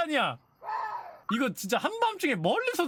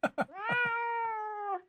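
high laugh; 11 semitones higher, 5 dB quieter than usual. Real, not acted. Laughs after outburst.

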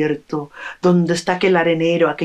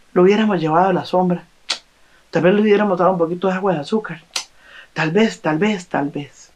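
regretful rush; much faster than usual. Acted. Villagers regret the man's death.

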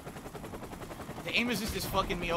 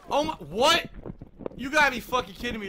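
higher, louder, rising disbelief; real stunned protest; incredulous; 3 semitones higher, 4 dB louder than usual. Real, not acted. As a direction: cry out as if cheated. Disbelief at game death.

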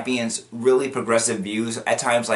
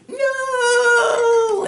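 long, high wailed shout; hammed-up villain's defeat scream; mock anguished cry; 4 dB louder than usual. He loses all his powers, mock anguished shout.

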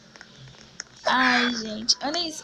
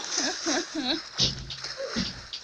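nervous laugh; 4 semitones higher, 7 dB quieter than usual. Real, not acted. After the scare.

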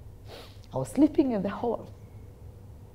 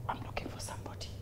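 whispered, quick and hushed; very soft whispered imitation; imitating woman preacher; 16 dB quieter, much faster than usual. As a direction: murmur it like a preacher searching the room. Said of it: "You know the way she speaks".

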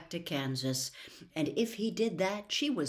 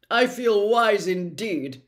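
loud, slow, earnest straw-man voice; 3 semitones higher, 10 dB louder, slower than usual. Acted. Scarecrow answering Dorothy, loud, slow and earnest. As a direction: say it proudly and earnestly.

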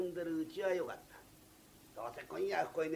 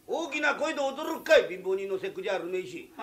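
loud retort; 8 dB louder than usual. Acted. Drunk mocks his boss loudly. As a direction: throw the jab back loudly.

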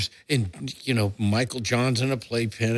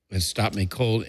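ordinary delivery

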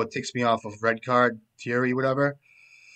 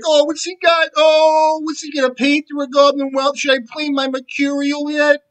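much higher, louder, fretting mock voice; mock nervous opponent; 14 semitones higher, 8 dB louder than usual. Streamer mocks the opponent's nervous thoughts, high and loud. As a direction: squeal the worries like a jittery psychic. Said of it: "He's like".

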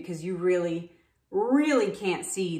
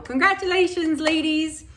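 excited; 7 semitones higher, 3 dB louder than usual. Real, not acted. Cheerful congratulations to winners.

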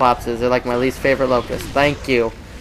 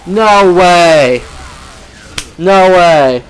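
loud shocked no way; disbelieving shout; 4 semitones higher, 8 dB louder, much slower than usual. Real, not acted. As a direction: yell it in stunned disbelief. Shocked outburst at defeat.

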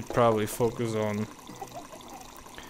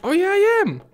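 high-pitched, louder burst of excitement; excited realisation; 5 dB louder than usual. Real, not acted. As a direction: squeal it with sudden delight. Excited realisation while playing game.

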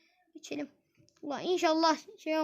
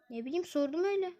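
ordinary talk

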